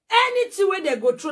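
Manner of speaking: real anger bursting out; furious shout. Real, not acted. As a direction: shout it high and loud in rage. Furious rant at rival.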